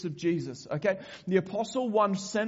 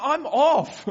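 higher, louder, laughing "I'm off"; mock quitting; 6 semitones higher, 6 dB louder than usual. Preacher imagines himself giving up on the people, mock exasperated. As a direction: throw up your hands and quit, laughing. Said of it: "I'd be like".

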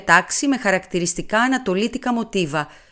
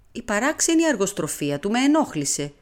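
usual speaking voice

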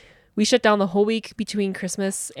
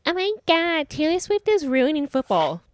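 high, mock-outraged whining; mocking whiner; 9 semitones higher than usual. Mocking football fans who blame Taylor Swift, high mock whine. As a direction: sneer at the complainers in a silly high voice. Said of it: "stupid people out there who are like".

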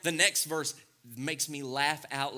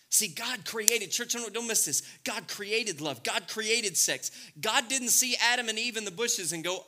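ordinary talk, nothing marked

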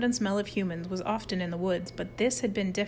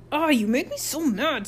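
high-pitched angry whine, mildly screamed; mild storybook scream of fury; screamed in anger; 6 semitones higher than usual. A narrator's reading. Harold screams at Rudy, high and mad. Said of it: "screamed Harold".

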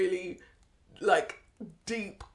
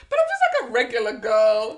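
airhead model voice; 7 dB louder than usual. Retold or imitated. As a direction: gush like a vapid model. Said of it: "They'll be like".

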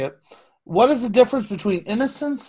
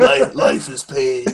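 mock goth gloom; 2 semitones lower, 5 dB louder than usual. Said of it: "way too hot to be goth".

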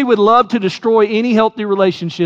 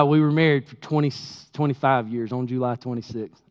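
trailing off quieter, fumbling the number; hesitant first try; 6 semitones lower, 7 dB quieter than usual. Real, not acted. Pastor fumbling his anniversary date, unsure and quieter. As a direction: say it unsure and drop away softer. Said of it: "Got it all right".

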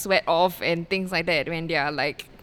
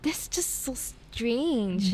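very slow, soft disbelief; slow and drawn out; 4 semitones higher, 6 dB quieter, much slower than usual. Real, not acted. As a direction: stretch every word out.

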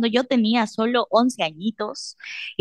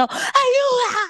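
very high crying wail, hammed up; mock panicked cry for help; much slower than usual. Mother stuck on the escalator, high mock panic cry. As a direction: shriek for help like you're falling.

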